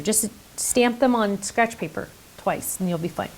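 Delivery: ordinary delivery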